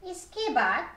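ordinary delivery, nothing marked

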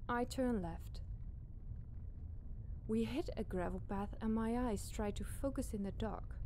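ordinary delivery

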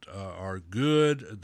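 ordinary talk

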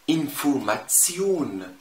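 slow انفورماتسيون with TS before the long last syllable; TSION ending. Shown as a demonstration. Teacher giving an example word, slow TS in انفورماتسيون. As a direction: stretch انفورماتسيون, tion said as tsion. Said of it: "بنطقهم تسيون".

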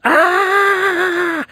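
very high shrieking wail; high wailing yell. A narrator's reading. Boy mortified at the mall, shrieking wail. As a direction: let out an anguished high cry. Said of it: "Both mums yelled".

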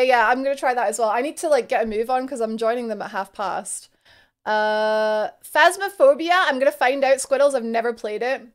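normal talking voice

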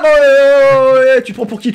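loud, high drawn-out protest; indignant shouting. Real, not acted. Player challenging an attacker over there, indignant shout. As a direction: bellow in outrage, high-pitched.

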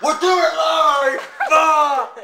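high, loud, slow-paced outburst; hammed-up tantrum at full volume; rage shout. Gamer throwing a tantrum for friends, rage shout.

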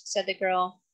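plain reading voice

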